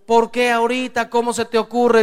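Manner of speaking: hammed-up scolding from the wife; mock yelling wife. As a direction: shout it like an angry wife. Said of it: "Viene tu mujer gritando".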